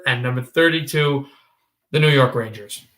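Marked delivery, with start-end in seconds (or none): none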